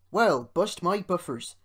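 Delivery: loud character voice taken aback; exclaiming. A narrator's reading. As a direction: exclaim it in hearty astonishment. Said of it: "exclaimed Rusty".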